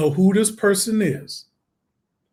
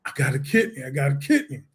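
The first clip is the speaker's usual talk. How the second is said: stunned quiet; 4 semitones lower, 6 dB quieter, faster than usual. Retold or imitated. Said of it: "telling Chad the news".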